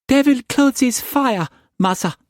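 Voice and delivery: very high, frightened pidgin servant voice; a high timid maid, pleading; frightened servant girl. A narrator's reading. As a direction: servant girl answering her master in fright. Servant Assam answers Struan.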